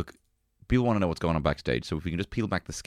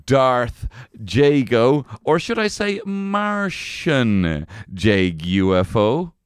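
loud, drawn-out ringmaster introduction; grand announcer intro; 4 semitones higher, 7 dB louder, much slower than usual. Acted. Host introduces his co-host with a slow, loud boxing-announcer flourish.

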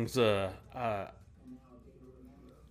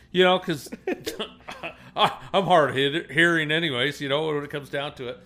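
ordinary talk

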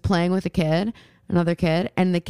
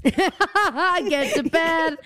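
high, very slow line through a laugh; imitating Jenny Slate; 7 semitones higher, much slower than usual. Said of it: "Jenny Slate energy".

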